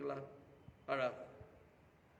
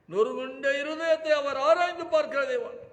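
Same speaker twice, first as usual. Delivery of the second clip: impassioned preaching; 12 semitones higher, 13 dB louder than usual. Acted. Preacher to congregation about the widow, full-force preaching. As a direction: lift your voice as a preacher crying out for the widow.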